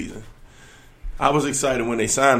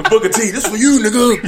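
loud, high, laughing wrestler shout-out; wrestling promo; 9 semitones higher, 7 dB louder than usual. Retold or imitated. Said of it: "he cut a wrestling promo".

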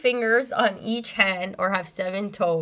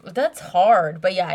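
ordinary talk, nothing marked

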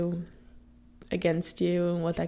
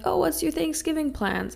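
higher-pitched imitation of a chirpy coworker; coworker's casual question; 9 semitones higher than usual. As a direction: lightly voice a coworker, pitched well up. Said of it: "somebody at work the other day was like".

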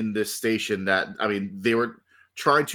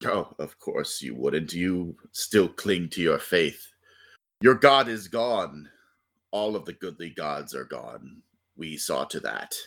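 villain menace; 9 semitones lower than usual. Acted. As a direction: sneer at their faith, ancient and cold. GM voices dark god taunting.

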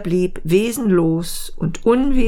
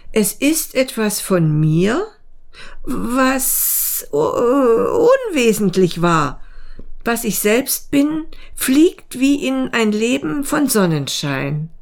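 higher, hesitant mayfly voice; dreamy mayfly; 6 semitones higher than usual. Acted. Mayfly explaining its cast-off shell, dreamy and hesitant.

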